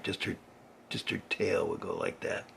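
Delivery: hushed aside; quiet. A narrator's reading. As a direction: say it very softly. Quiet cat tail aside.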